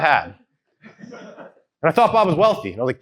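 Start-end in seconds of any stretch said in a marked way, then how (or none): 1.1-2.7 laughing. Real, not acted. Laughing at his own story.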